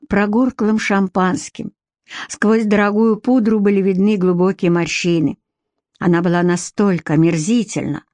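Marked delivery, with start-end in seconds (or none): none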